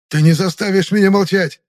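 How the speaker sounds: high, loud, defiant outburst; raging defiance; furious. A narrator's reading. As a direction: refuse angrily at full voice. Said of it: "яростно прохрипел Темноликий".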